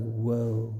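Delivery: soft, low awed whoa. Retold or imitated. Preacher imitating someone seeing a big storm, soft low awe. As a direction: breathe it out in quiet wonder.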